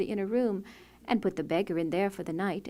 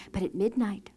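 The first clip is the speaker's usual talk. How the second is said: ordinary delivery, nothing marked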